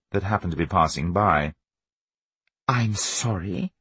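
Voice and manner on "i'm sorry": slow, drawn-out, not sorry at all; pointedly unapologetic sorry; insincere apology. A narrator's reading. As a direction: apologise without meaning a word. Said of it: "in that tone of voice which indicated that she was very far from sorry".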